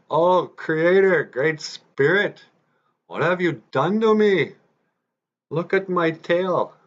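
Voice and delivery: high, loud, drawn-out wail; hammed-up lament to the Creator, slow and high; mock crying. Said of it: "And he started to cry".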